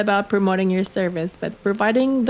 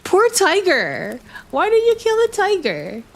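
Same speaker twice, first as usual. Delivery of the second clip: high pitying voice; 9 semitones higher than usual. Real, not acted. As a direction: plead for the poor tiger. Pitying the killed tiger.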